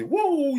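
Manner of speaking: high mock woo call; Flair woo. Imitating the crowd's call for Ric Flair, high woo.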